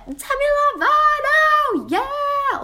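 shrieked very high and loud; mock screaming fans. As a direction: scream it like drunk roommates. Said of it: "de skriker så här". (skriker som fulla rumskompisar)

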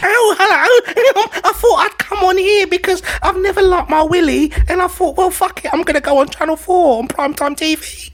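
camp, giggly Scouse contestant impression; Scouse accent. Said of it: "who's a Scouse accent".

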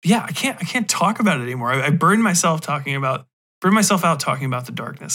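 ordinary talk, nothing marked

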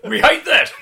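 very high, very loud yell; heckling crowd shout. Acted. Audience shouting at a comedian they know, heckling yell.

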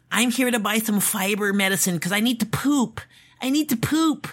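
weird voice. Retold or imitated. Mimicking a man high on drugs caught in a store, weird voice. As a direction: imitate a zonked-out guy in a high voice. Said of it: "he has a weird voice".

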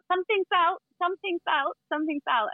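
high sing-song call, repeated; imitating market sellers calling out; market vendor call. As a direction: call it out like a street vendor in Thailand. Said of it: "everyone would shout out".

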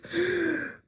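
sharp, high intake of breath; imitated panic gasp. Recalling sick panic before a drink, sharp mock gasp. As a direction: gasp as if dread suddenly rises.

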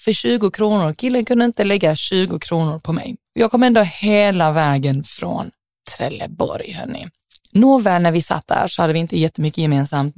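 normal talking voice

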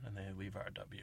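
usual speaking voice

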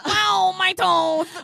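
very slow, high and loud; drawn-out poodle wail. Retold or imitated. Quoting the poodle's wail, slow, high and loud. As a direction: moan the loss out long.

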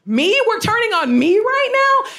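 high-pitched, indignant disbelief; incredulous protest. Retold or imitated. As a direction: re-enact being outraged at getting the blame. Retold disbelief at being blamed.

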